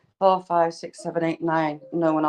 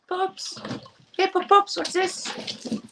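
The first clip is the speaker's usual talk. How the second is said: much higher coaxing puppy-talk; calling puppies; 11 semitones higher, slower than usual. Real, not acted. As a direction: sing out high to lure the pups.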